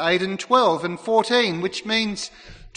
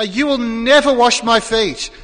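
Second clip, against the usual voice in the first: emphatic refusal; 2 semitones higher, 7 dB louder than usual. Retold or imitated. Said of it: "It was emphatic".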